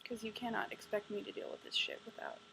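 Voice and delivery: ordinary talk, nothing marked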